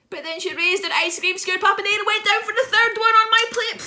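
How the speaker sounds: loud, high-pitched mock panic; hysterical horror. Author reliving the third scoop landing, loud shrieked horror. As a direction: shriek the climax in melodramatic horror.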